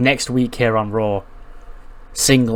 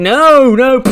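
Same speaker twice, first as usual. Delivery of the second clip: shrill, much higher, much louder tantrum cry of no; hissy fit; 12 semitones higher, 8 dB louder than usual. Retold or imitated. Mackie throws a tantrum as her microphone is stolen, shrieking. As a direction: stamp and wail like a spoilt child. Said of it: "throwing a hissy fit".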